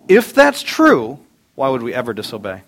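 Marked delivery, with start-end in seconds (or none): 0.1-1.2 high, loud, slow preacher build; preaching emphasis. Real, not acted. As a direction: lift it up and lean on it.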